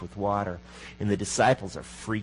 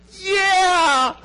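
a sudden high, loud whoop of joy; excited cheer; 6 dB louder than usual. Retold or imitated. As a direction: cheer it like you just won. Cheering the realization of love.

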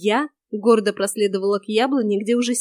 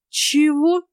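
higher-pitched startled question; taken aback; 6 semitones higher, 4 dB louder than usual. A narrator's reading. Heroine hearing she is his bride, taken aback. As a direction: blurt it in disbelief. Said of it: "опешила я".

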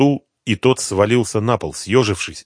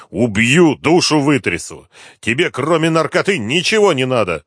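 raised, louder angry outburst; angry threat; 6 semitones higher, 6 dB louder than usual. Acted. Inspector threatens to kill the addict, angry outburst. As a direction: threaten him while shaking him.

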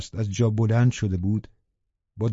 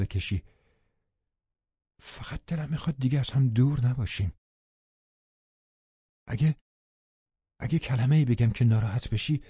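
softer, tender, the name barely breathed; gentler; 4 dB quieter than usual. A narrator's reading. Dying woman gently begs her lover, softer and tender. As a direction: soothe him quietly.

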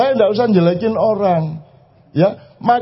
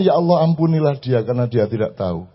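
prayerful; 8 semitones lower than usual. Retold or imitated. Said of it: "terus aku ngene".

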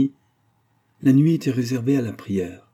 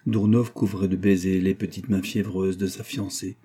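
ordinary delivery

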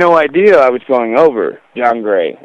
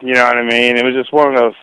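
ordinary delivery

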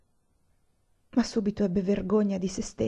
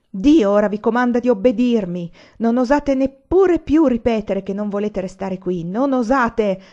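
loud, raised-pitch raging command; delirious fury; 3 semitones higher, 6 dB louder than usual. A narrator's reading. Anania forbids the women to speak of leaving, delirious rage. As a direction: order them as if out of your mind. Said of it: "egli disse come in delirio".

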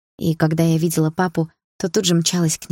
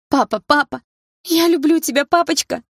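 much higher and louder desperate cry; shouting; 10 semitones higher, 3 dB louder than usual. Acted. Daughter crying out after her father turned to wind, anguished shout. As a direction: call after him with all your heart. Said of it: "кричала я".

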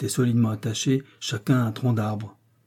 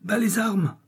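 yelled command; 9 semitones higher than usual. A narrator's reading. Franz stopping the firing squad, yelling. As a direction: pitch the command far up. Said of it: "hurla Franz".

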